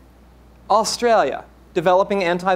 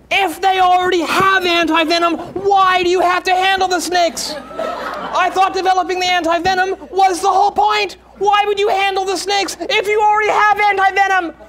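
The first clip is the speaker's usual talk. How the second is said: much higher and louder shouting; shouting mother; 14 semitones higher, 7 dB louder than usual. Acted. Mother shouting at son about the snakes. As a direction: yell the question in disbelief. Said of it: "You're shouting".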